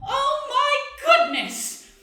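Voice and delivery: high, loud gushing cry; hugely thrilled, sing-out exclamation; delighted wizard. Acted. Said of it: "when you hear".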